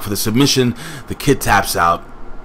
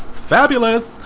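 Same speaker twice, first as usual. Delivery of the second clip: very high-pitched catchphrase imitation; mock flamboyant high cry; Aaron Rex; 13 semitones higher, slower than usual. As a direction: squeal it out, drawn-out and showy. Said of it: "walking around with Aaron Rex".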